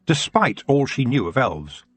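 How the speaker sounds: usual speaking voice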